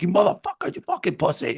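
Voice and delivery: high, heated mock yell at the guy; retold insult. Fan retells cursing the fan who shoulder-bumped him, high and heated. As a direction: snap at the guy who ran off. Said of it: "I was like".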